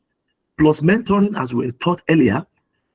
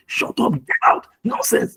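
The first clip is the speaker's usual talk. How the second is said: high, strained angry outburst; retold angry snap; slower than usual. Driver snapping at another motorist in Lagos, angry retold snap. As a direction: snap it out, pitch leaping up. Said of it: "And I snapped".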